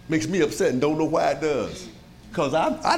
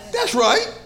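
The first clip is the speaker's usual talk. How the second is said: excited affirmation; 14 semitones higher, 5 dB louder than usual. Real, not acted. Preacher affirms.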